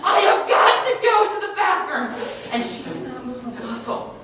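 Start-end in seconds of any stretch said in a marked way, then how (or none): 0.0-2.1 desperate yell. Retold or imitated. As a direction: bellow it out half laughing. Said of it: "And I was like".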